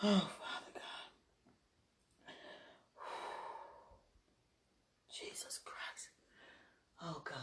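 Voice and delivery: exhausted whisper; sighed whisper. Real, not acted. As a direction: breathe it out on a sigh. Sighing whispered exclamation.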